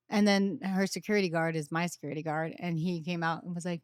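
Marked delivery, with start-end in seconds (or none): none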